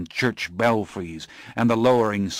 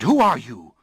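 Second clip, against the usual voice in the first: louder, forceful demand; 3 semitones higher, 5 dB louder than usual. Acted. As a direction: insist on an answer. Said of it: "I demanded".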